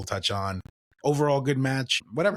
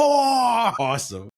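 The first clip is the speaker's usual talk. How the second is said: excited; 11 semitones higher, 6 dB louder, much slower than usual. Real, not acted. Excited reaction to the fan.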